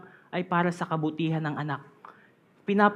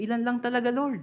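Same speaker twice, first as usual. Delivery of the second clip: usual speaking voice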